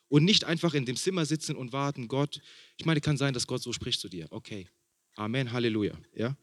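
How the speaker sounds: ordinary delivery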